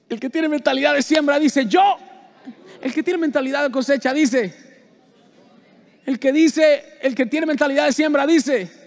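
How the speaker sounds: high, loud call-and-response preaching; shouting. Real, not acted. Preacher shouting to make the congregation answer. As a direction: drive it home to the crowd.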